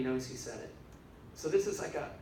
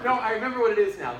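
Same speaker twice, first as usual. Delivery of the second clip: excited recall; 6 semitones higher, 10 dB louder, faster than usual. Real, not acted. Suddenly recalls forgotten point.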